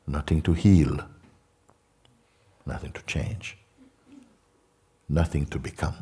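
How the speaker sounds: hushed meditation voice with long pauses; guided meditation. Real, not acted. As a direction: guide them into emptiness, leaving long silences.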